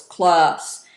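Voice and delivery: loud, low-toned "class". Shown as a demonstration. Teacher showing a varied call to the class, low tone. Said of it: "in a low tone of voice".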